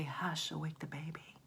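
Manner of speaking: soft, whispered hush; gentle whisper. Shown as a demonstration. Teacher showing what parents are not gonna say, whispering. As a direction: whisper it with no force at all. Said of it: "they're not gonna say".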